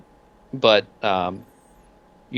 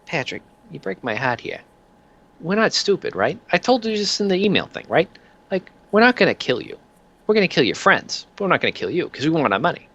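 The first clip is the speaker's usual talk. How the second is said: high-pitched wheedling thug making threats; reasonable-sounding mob enforcer; 5 semitones higher than usual. Acted. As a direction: lean on a debtor as if it were friendly advice. Peanuts threatens the debtor.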